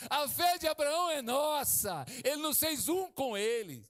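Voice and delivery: loud, high-pitched preaching climax; preaching at full force. Real, not acted. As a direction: proclaim it loud and high, punching the key words. Pregação em força total. (pregação inflamada, em voz alta)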